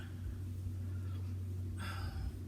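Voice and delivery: a long breathy sigh; long weary sigh. Real, not acted. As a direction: let out a tired sigh. Tired of slow stitching.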